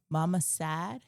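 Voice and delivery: put-on small, gentle toddler asking. Toddler daughter comforting crying mom.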